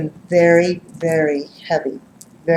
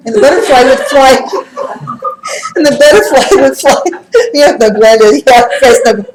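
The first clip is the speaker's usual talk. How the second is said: laughing through the words, louder and higher; 8 semitones higher, 9 dB louder than usual. Real, not acted. Telling friends about the butterfly, laughing loudly. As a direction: crack up while telling it.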